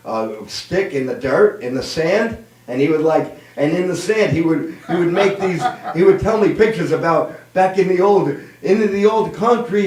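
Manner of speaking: ordinary talk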